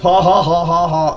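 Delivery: loud, high "ha ha" guffaw; mock belly laugh. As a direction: laugh loudly in their faces. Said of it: "So I'm laughing my ass off".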